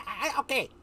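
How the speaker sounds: high, loud impersonation of a friend; Hazel. Retold or imitated. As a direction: imitate her, pitched high and stammering. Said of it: "I could do a good impersonation of her".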